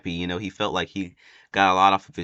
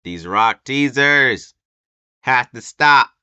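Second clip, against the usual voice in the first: louder, higher, slow exclaim of fan frustration; exasperated outburst; 6 semitones higher, 10 dB louder, slower than usual. Real, not acted. Fan venting to viewers about the Rock teasers, loud exasperated outburst. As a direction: throw your hands up at the teasers.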